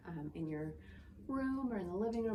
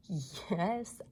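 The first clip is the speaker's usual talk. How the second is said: normal talking voice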